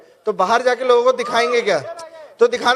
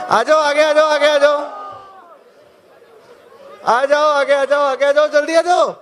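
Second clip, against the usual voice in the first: calling out; 3 semitones higher than usual. Real, not acted. Calling the crowd forward.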